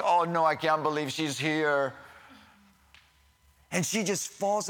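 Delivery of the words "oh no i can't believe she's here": loud, scandalized dinner-guest voice; mock shock. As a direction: act aghast at an unwelcome arrival. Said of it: "everybody's just shocked".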